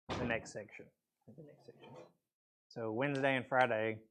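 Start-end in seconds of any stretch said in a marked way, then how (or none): 1.4-1.9 soft whispered echo of the phrase; whispered repeat. Shown as a demonstration. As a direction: whisper it back to yourself.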